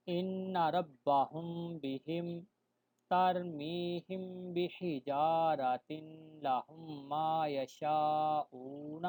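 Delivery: ترمیہم بحجارۃ chanted slowly with long vowels; slow recitation. Shown as a demonstration. Teacher reciting verse examples to students, slow chant. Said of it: "teacher recites examples".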